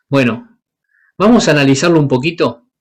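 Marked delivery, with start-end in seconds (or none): none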